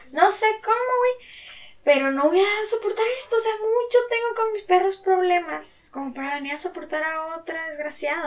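the moment she's had enough; fed up outburst. Retold or imitated. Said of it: "que dije".

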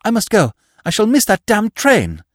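put-on a young man realising he'll miss his train, crying out high and loud; alarmed cry. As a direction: jump up in alarm. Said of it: "He bounded up with a cry".

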